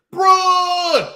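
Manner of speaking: high, loud drawn-out name; mock roar. What the wrestler heard behind him, mock roar. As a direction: roar the name like an entrance call. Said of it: "So he heard".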